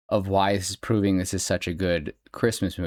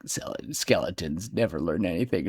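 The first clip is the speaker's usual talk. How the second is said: grumpy Santa; 5 semitones lower, 5 dB quieter than usual. Retold or imitated. Santa grumbles about Jack.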